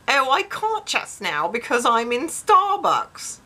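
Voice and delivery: high, slow, drawn-out excuse; put-on caller voice. Reads quoted excuse in put-on voice.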